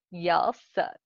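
drawn-out Southern twang; southern accent. A narrator's reading. Boss mocks coworkers' names, Southern accent. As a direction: put on a mild Southern accent. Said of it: "He then spoke in a southern accent".

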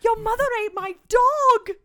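high, loud, horrified yell; outraged shout. Retold or imitated. Man discovering his dog eaten, shouting in horror. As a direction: scream it in horror.

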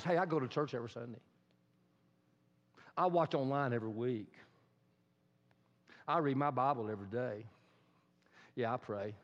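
low, smug understatement; self-righteous. Acted. Churchgoer boasting of weekly habits, low-key self-righteous. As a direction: list it off low and self-satisfied. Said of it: "get a little self-righteous".

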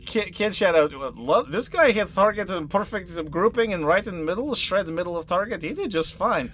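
a hint of a proud range master; NPC voice.

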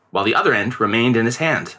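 usual speaking voice

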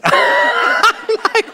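words squeezed out through a high laugh; real laughter breaking through. Real, not acted. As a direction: crack up while starting the sentence. Laughing at inmate's request.

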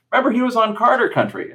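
high, loud, fast imitation of mom; imitating mom. Son imitating his mom recalling the sitcom, high and fast. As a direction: rattle it off high like mom remembering.

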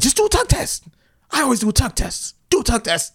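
high bossy mock trainer. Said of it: "He was like".

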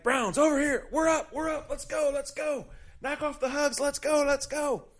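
high, loud hammed-up coach shout; coach yelling. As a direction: bark at the players to regroup like a strength coach. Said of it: "strength coach comes flying through".

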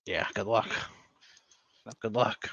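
dry, low-pitched sarcasm; sarcastic. Real, not acted. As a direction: say it drily, doubting it will ever happen.

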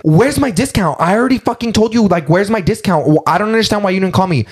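high-pitched, mock-indignant quote; worked-up retelling of an argument; agitated retold demand. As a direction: imitate a pestering customer, pitched high. Retold angry phone exchange.

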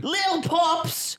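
high, drawn-out playful holler; mock shout. Podcaster hollering about his feet, playful drawn-out shout. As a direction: stretch it into a slow, hammed-up yell. Clowning shout after being teased.

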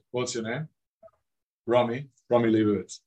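normal talking voice

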